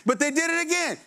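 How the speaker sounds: high, whining complaint; mock whine that they did it again; whiny protest. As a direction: protest like a sulky child. Whiny objection voiced.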